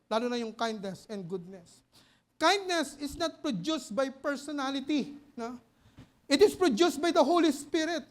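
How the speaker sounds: booming sermon delivery; full-force preaching. Acted. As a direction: drive each point home from the pulpit. Preacher building to loud full-force points.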